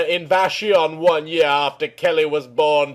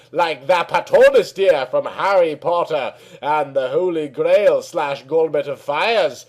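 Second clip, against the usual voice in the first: ordinary delivery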